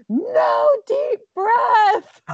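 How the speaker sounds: high, slow screaming wail; toddler scream. Retold or imitated. Daughter screaming at mom during tantrum, shrill wail. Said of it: "she was literally screaming".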